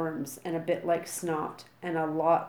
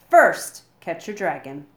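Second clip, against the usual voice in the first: loud announcement; 13 dB louder than usual. A narrator's reading. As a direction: blast the first word after the pause. Gobber's dramatic big announcement.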